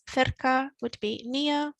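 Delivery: slow, clear "Cerca" and "lejos"; slow teaching pace. Shown as a demonstration. Teacher repeating vocabulary, slow and clear. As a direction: lay out each word slowly. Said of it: "It's easy to forget little words".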